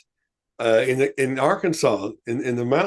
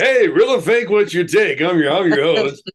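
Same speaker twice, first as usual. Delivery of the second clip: game show host; 3 semitones higher than usual. Acted. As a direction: announce it like a TV host. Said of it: "it sounds like a game show host".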